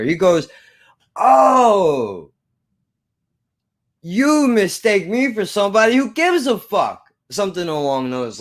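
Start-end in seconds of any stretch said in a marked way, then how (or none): 1.1-7.0 mock sympathy. Manager dismissing teen with no ride home, high sarcasm. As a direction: imitate a boss scoffing, pitched way up. Manager mocks teen's plea, retold.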